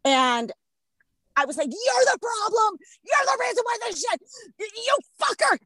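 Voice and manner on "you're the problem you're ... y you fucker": high-pitched retold tirade; furious. Woman re-enacting her tirade at the maskless guy, furious and high. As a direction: hurl the blame in a high, re-enacted yell.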